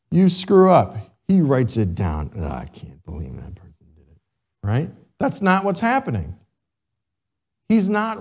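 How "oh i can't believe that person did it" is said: much lower, much softer, fast disapproving mutter; tut-tut over the sinner's record; scolding God. Retold or imitated. God writing down a sin, disapproving.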